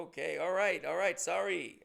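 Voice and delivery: mock-defensive placating; flustered apology. Customer to yelling bartender, flustered apology. Backing down after being yelled at.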